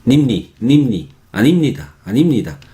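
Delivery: "animnida" quick, with nimni run together almost as one syllable; sílabas enlazadas. Shown as a demonstration. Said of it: "dos sílabas se pronuncian muy rápido". ("animnida" con las sílabas enganchadas, dicho rápido)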